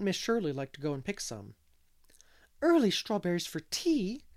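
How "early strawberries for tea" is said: higher, louder delighted exclamation; exclaiming. A narrator's reading. Miss Lavendar delighted by the strawberry news, exclaiming higher and louder. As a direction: burst out with delight. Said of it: "exclaimed Miss Lavendar".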